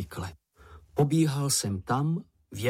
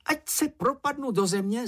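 high little creature voice; gabbled oath; 13 semitones higher than usual. A narrator's reading. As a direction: babble a vow in a lightly squeaky voice. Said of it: "zabrebentil Čenich". (vysoké brebentění)

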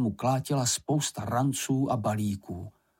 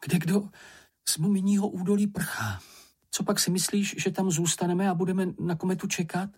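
higher-pitched, mildly urgent delivery; hurried; 8 semitones higher than usual. A narrator's reading. Fleeing traveller explains the exodus, a touch hurried. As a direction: tell it as someone anxious to get away. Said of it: "řekl chvatně".

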